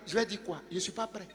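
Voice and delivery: hushed, unsure voice playing the reluctant believer; a listener excusing himself, said small and quiet; mock timid reply. Preacher mimics a believer refusing to preach, hushed and unsure. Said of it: "tu dis".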